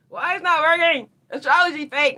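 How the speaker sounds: high, loud mock whine; whiny complaint. Mocking a frustrated believer's loud high whine. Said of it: "you're like".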